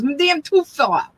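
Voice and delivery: high-pitched mock mumbling; imitated mumbled complaint. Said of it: "mum-, mumbling".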